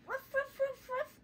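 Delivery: tiny high-pitched yip barks; squeaky yips like a toy puppy. Pretend. Mom barks for the doll's toy puppy, high yips.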